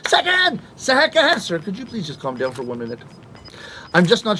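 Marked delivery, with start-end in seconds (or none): 0.1-1.4 higher pitched, insistent repeating of second; imitating his frantic repeating; mock pleading. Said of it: "I was saying to him again and again". 1.4-3.0 much lower, softer shop clerk; a soothing clerk calming a customer. Retold or imitated. Clerk voiced in story.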